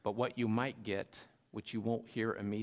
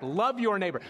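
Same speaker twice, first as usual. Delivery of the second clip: louder and higher, preaching the command; emphatic quote; 7 semitones higher, 6 dB louder than usual. Retold or imitated. Preacher quoting Jesus to the congregation, emphatic. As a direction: proclaim the commandment firmly. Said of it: "He says, matter of fact".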